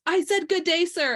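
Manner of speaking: Gene Wilder. Retold or imitated. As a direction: dismiss someone with theatrical indignation. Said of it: "Gene Wilder goes".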